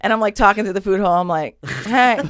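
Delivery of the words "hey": higher, louder friendly call; trying to be nice. Retold or imitated. Inmate calls through the food hole, trying nice. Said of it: "I'm trying to be nice at this point".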